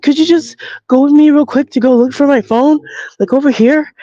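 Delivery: high-pitched imitated pleading; upset young woman. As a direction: ask it in a mimicked young woman's voice. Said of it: "this is how she talks".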